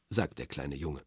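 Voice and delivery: ordinary delivery, nothing marked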